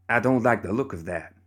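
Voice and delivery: plain reading voice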